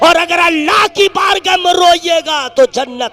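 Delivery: very loud, high, slow oratory; sustained chanting sermon shout; full-force preaching. Real, not acted. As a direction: thunder it out to the crowd, stretching the words.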